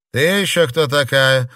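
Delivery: haughty. A narrator's reading. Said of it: "надменно спросила".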